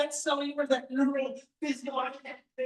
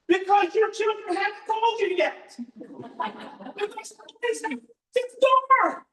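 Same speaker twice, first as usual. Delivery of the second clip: higher and louder, building to a shout; rising rousing praise; 7 semitones higher, 6 dB louder than usual. Real, not acted. To the seminar crowd, building to loud excited praise. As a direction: pump the crowd up till it cheers.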